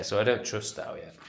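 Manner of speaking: normal talking voice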